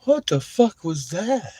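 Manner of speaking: high, slow, disbelieving cry; a high, drawn-out what-the-hell; baffled outburst. Retold or imitated. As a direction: blurt it out, stunned by the flash. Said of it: "and it's like".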